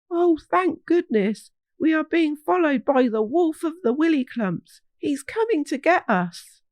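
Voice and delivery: high, urgent and relieved; scared children. Acted. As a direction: blurt it out in a rush of fear. Frightened children plead.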